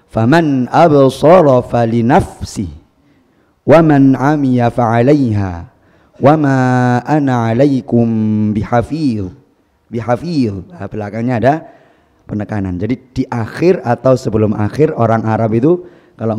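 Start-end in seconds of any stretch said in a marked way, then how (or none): 0.1-10.6 slow recitation, bihafil stressed near the end then falling; Arabic-style stress. Shown as a demonstration. Teacher reciting the verse Arabic-style for students, slow. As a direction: stretch each phrase and press the syllable before the last.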